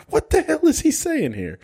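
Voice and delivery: squeaky, baffled question. Retold or imitated. Rewinding the movie, squeaky and baffled.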